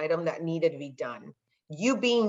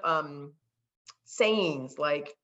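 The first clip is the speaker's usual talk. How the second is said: usual speaking voice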